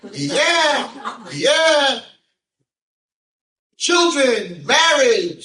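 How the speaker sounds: put-on very high, strained old rabbi voice, slow; old rabbi's blessing. Rabbi blessing the girl who has no lines, in a high old voice. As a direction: croak out a blessing like an elderly rabbi. Rabbi promising marriage, retold.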